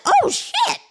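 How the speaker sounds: high squeal of disbelief; shocked gasp. Retold or imitated. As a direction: pitch jumps way up on a shocked oh shit. Shocked reaction to insult.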